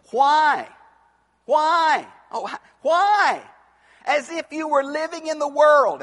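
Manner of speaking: high, loud, punched sermon questions; forceful preaching. Acted. Preacher challenging Christians living in two kingdoms, forceful. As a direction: hammer it out from the pulpit.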